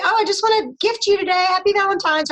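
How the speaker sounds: bright, high, gushing holiday greeting; mock-cheerful greeting to a friend; cheery gift greeting. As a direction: gush as you hand over a gift. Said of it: "hand somebody something and say".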